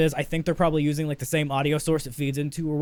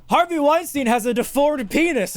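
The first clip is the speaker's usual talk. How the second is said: higher, animated quoting of the podcast; shocked retelling; 9 semitones higher than usual. Retold or imitated. Friend retelling a shocking podcast bit, animated. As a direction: hit it like a punchline.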